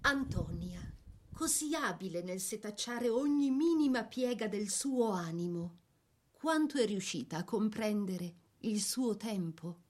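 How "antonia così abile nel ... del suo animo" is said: normal talking voice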